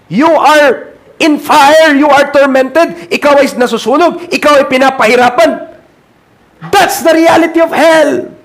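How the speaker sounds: building fiery sermon cry; preaching at full force. Acted. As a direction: drive it home like a fiery preacher. Preaching climax on hell torment.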